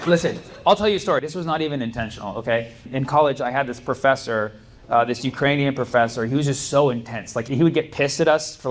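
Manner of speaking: usual speaking voice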